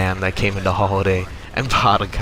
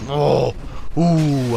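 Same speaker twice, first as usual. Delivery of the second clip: exasperated groan; 5 semitones higher than usual. Retold or imitated. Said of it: "I was getting mad at this lady".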